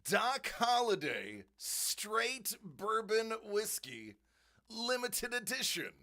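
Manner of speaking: loud, high, slow label reading; amazed proclamation. Real, not acted. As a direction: announce each word slowly with excitement.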